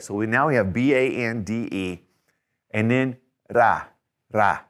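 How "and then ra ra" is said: "ra" with a single tapped R; Spanish flapped R. Shown as a demonstration. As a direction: tap the tongue once for "ra". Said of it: "There again is that flap".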